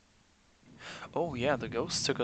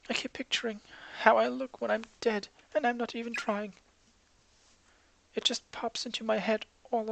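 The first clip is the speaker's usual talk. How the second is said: put-on higher, fragile girl pleading; despairing schoolgirl; 10 semitones higher than usual. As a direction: let her fall apart, wanting home. Girl breaking down, wants home.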